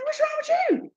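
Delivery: light high-pitched impression of someone asking; mimicked concern. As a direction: mimic the companion in a high, small voice. Retells companion's question.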